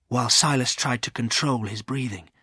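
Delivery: plain reading voice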